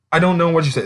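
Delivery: ordinary talk, nothing marked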